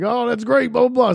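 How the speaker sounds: high mocking singsong. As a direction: brush him off in a high voice. Mocking the guy's chatter.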